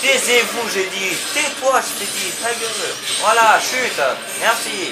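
high-pitched, irritated commands; exasperated scolding. Real, not acted. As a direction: order noisy enemies to shut up. Orders game enemies to shut up.

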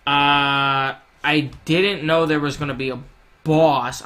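loud startled exclamation; alarmed surprise. Real, not acted. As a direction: caught off guard, suddenly loud. Surprise boss appears mid-run.

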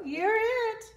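high, loud game call; excited high-pitched Cow; playful Cow. Acted. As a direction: shout it like a tag. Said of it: "said Cow".

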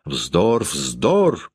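usual speaking voice

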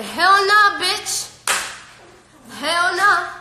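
loud, high outburst; emphatic refusal. Real, not acted. Rejecting a warning about the dog.

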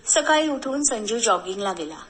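ordinary delivery

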